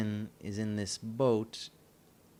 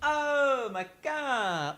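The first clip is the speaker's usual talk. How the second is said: much higher, slow drawn-out amazement; amazed; 4 dB louder, much slower than usual. Real, not acted. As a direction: gasp it out in disbelief. Reacting to wine in taxi.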